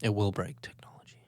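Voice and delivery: ominous hushed whisper. Pretend. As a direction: breathe it like a secret.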